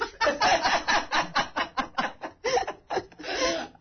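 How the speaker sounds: long loud laugh; hearty giggling fit; real laughter. Real, not acted. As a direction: laugh hard at your own shout out. Shout out to doctor.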